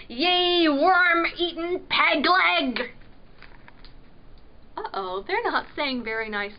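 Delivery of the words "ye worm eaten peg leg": slow, loud pirate voice; pirate insult. A narrator's reading. Pirate trading insults with the prince, angry.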